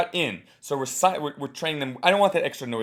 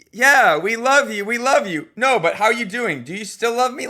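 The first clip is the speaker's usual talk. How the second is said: higher, louder, exasperated crowd; mock back-and-forth; 8 semitones higher, 7 dB louder than usual. Coach acting out crowd and comic, mildly exasperated. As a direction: play both sides, getting fed up. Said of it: "crowd and comic bicker".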